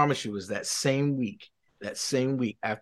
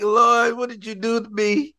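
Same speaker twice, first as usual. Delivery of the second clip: high-pitched comic cry to heaven; mock panicked plea; 11 semitones higher, 6 dB louder than usual. Man complaining to God as the lady cries, mock panicked plea. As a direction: plead upward, loud at first, in playful dismay.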